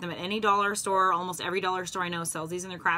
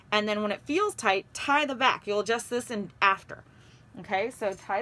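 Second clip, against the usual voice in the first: muffled through cloth mask; talking behind fabric mask; speaking through a face mask; 3 semitones higher than usual. Real, not acted. Seen 0: Cloth mask held over mouth and nose throughout while tying.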